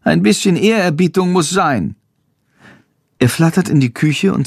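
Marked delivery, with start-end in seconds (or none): 0.0-1.9 high bat voice calling halt; little bat stops the lid from closing. A narrator's reading. Bat calls to Jendrik to wait, high and urgent. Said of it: "ruft Chandor".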